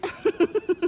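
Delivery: high teasing giggle; teasing laugh. Acted. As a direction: laugh at her friend's crush.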